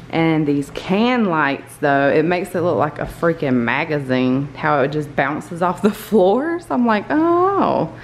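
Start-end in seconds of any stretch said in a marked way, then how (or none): none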